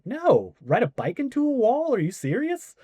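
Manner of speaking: high, incredulous "are you serious"; incredulous reply. Retold or imitated. Answering the producer who asks if he's done this, incredulous and high. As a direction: act shocked at the question.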